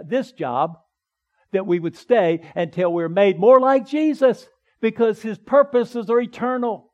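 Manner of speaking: rising, loud preaching build; preaching at full force. Acted. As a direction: drive the point home to the congregation.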